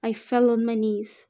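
usual speaking voice

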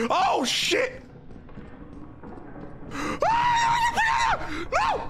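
terrified high scream; scared horror game streamer. Real, not acted. Chased by monster.

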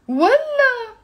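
والله very high and loud, falling; scornful refusal. Shown as a demonstration. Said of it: "I'm saying, "Of course not".

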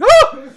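sudden high, loud shriek; imitating a startled doctor's scream. Specialist seeing the patient walk, shocked scream. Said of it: "and she screamed".

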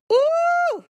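loud, very high-pitched "ooh". Real, not acted. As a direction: squeal a recoiling "ooh". Reacting to a bad apology example.

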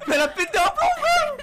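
high panicked shout with laughter; scared horror game streamer. Real, not acted. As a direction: shriek half laughing, half scared.

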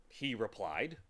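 usual speaking voice

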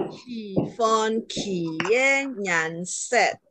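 very slow, each tone drawn out in "Shifon kie nyan set"; slow tone-by-tone demo of "Shifon kie nyan set"; rising and falling tones. Shown as a demonstration. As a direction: stretch every syllable of "Shifon kie nyan set" to show its tone. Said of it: "ada nada naik turunnya".

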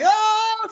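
put-on a kid panicking about being tickled; freaking out yelp. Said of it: "freaking out".

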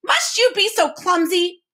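high-pitched, exasperated rebuke; shouted scolding. A narrator's reading. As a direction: snap at a clumsy colleague. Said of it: "shouted the Duke".

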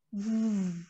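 V held and buzzing. Shown as a demonstration. Teacher demonstrating a vibrating V. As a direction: hold the V so it vibrates. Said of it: "eu seguro o som pra ele vibrar".

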